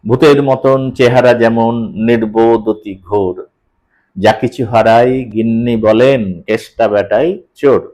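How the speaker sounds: rhythmic reading, stopping between feet; paused recitation. Shown as a demonstration. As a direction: read the verse with clear pauses at set places. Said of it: "এই যে ভূতের মতন, থামছি আমি".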